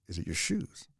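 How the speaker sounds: a hushed, breathy question retold from a show; soft imitated whisper, as if sharing a secret. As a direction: lean in and whisper it like a pointed hint. Tony Robbins quietly questions the guy.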